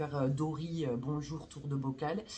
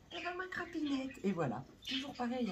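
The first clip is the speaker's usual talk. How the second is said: pet talk voice; 9 semitones higher, 3 dB quieter than usual. Real, not acted. Talking to her cat.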